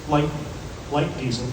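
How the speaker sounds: ordinary talk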